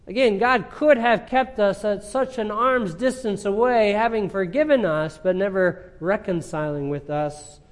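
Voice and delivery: high, loud preaching at full force; fervent preaching. Acted. Preacher to congregation on reconciliation, loud fervent preaching. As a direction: press the point home to the church.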